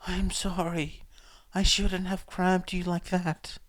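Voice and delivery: lightly voiced hoarse woman; hoarse cracked voice. A narrator's reading. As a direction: rasp it out gently, like a voice worn thin. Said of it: "Her voice was hoarse and cracked".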